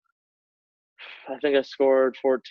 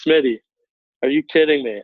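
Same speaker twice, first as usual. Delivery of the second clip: coach's call-out, a little louder; angry coach; 3 semitones higher, 5 dB louder than usual. Retold or imitated. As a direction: bark his name like a fed-up coach. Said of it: "and he's so pissed".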